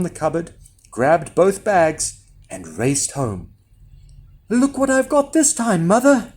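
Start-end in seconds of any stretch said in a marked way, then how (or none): 4.5-6.3 put-on high, excited boy's call; mild shout, pitched right up. A narrator's reading. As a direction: call out proudly to mother. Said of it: "he shouted".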